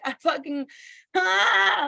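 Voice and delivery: high, loud, strangled half-laughing cry; screaming at God. Retold or imitated. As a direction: choke on the fury mid-scream. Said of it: "screaming at God".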